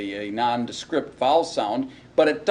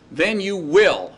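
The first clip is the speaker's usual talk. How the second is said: "will" leaned on, slow and a bit louder; stress on will; 4 semitones higher, 4 dB louder, slower than usual. Shown as a demonstration. Teacher stresses the certain rule, slow firm "will". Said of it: "here you can honestly say will".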